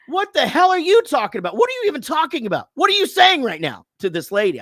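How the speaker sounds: high, loud, fast outrage; indignant yelling. Retold or imitated. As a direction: tear into her for Rob's sake. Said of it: "it just fucking kicks in".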